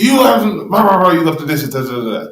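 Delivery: loud, high, fast angry burst; role-played angry husband yelling; abrupt angry outburst. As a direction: snap back in a mock rage. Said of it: "then she abruptly goes".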